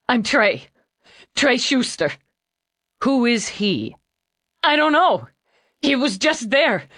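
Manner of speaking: put-on panicked young man crying hard; hiccuping tears. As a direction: plead through loud hiccuping sobs. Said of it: "between sobs and shakes".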